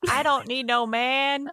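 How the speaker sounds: high, slow sassy declaration; sassy attitude. Retold or imitated. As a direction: toss it off with sass. Mock-sassy quote, both hosts.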